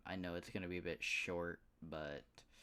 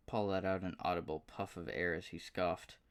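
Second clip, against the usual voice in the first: ordinary delivery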